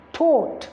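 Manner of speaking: "taught" with a long open O, /tɔt/; "taught" spoken slowly, showing its long O. Shown as a demonstration. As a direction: say "taught" with a long, rounded O vowel. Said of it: "the sound that is realized here is the long O sound".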